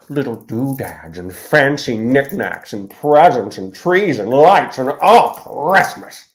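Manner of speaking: building Grinch rant. Retold or imitated. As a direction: wind up into an exasperated Grinch outburst. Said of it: "Jim Carrey Grinch impersonation".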